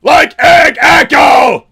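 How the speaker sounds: high, loud, slow excited shrieks; freaking out. Real, not acted. Streamer screaming at a donor's name, real panic. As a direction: lose it completely over the name. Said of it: "I'm freaking out!".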